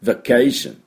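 vacation with a tiny VA, then strong CAY; only touch the first syllable of vacation, lift CAY; barely sounded VA. Shown as a demonstration. Teacher showing the first sound is only slight, reduced VA. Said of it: "最初のvaのあの音はちょっと出すだけ".

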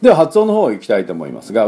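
usual speaking voice

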